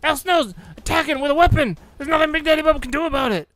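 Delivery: loud, high excited announcer call; hyped commentator. Pretend. Mock commentator call again.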